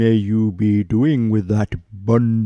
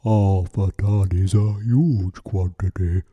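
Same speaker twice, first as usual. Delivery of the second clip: sighing; 5 semitones lower than usual. A narrator's reading. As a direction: bear sighs at the price, lower. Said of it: "sighed the bear".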